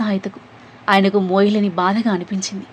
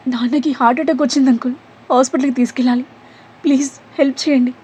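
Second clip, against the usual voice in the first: high, pleading voice near tears; desperate plea; 5 semitones higher, faster than usual. A narrator's reading. Daughter pleads with the driver to save her father. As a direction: beg the stranger for help, pitch climbing.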